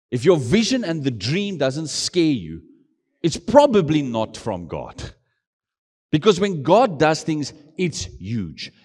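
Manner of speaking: slow, swelling preacher's call; closing a sermon, preaching slowly with full force. Acted. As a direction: drive each phrase home from the pulpit.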